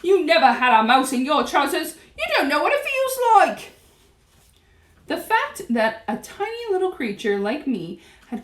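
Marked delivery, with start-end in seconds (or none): 0.0-3.7 loud protest climbing very high; shouting back. A narrator's reading. Cook defends himself to jeering staff, shouting back. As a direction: argue back, rising to a squawk. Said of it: "the man was shouting back".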